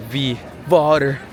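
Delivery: "Wie water" slow with Slavic W as V; playful Slavic accent. Shown as a demonstration. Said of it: "Slavic accent".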